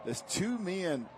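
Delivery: loud, high, emphatic breakdown; excited analysis. Real, not acted. Analyst explains the underthrown touchdown, loud and excited. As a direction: talk over the crowd, fired up.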